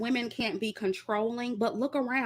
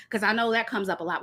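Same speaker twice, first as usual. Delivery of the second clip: normal talking voice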